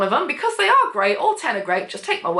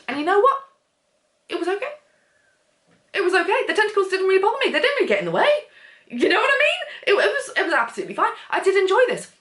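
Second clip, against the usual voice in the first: much higher, squeaky protest; high-pitched defensive insisting; 10 semitones higher, faster than usual. Real, not acted. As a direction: insist it was fine, voice climbing high. Defensively admits liking tentacle romance.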